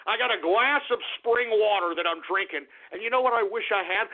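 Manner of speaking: ordinary talk, nothing marked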